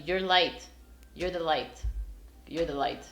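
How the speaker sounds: ordinary talk, nothing marked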